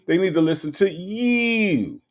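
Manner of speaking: high-pitched elongated imitation; Nesta. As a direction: stretch it out high, playfully mimicking. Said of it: "sound like Nesta when you say you".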